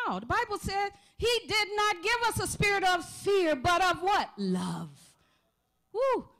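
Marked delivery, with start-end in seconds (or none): 1.2-6.3 high, loud rebuke of fear; fervent preaching shout. Real, not acted. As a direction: preach it with rising fire. Preacher rebuking fear, rising fervor.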